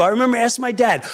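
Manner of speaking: normal talking voice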